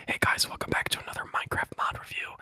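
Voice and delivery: fast ASMR intro whisper. Pretend. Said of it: "relaxing A S M R".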